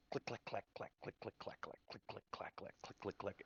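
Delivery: very soft, voiceless clicks and clacks; imitated clicking. Game master mimicking an odd sound, soft clicking. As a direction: tick out the sound under your breath.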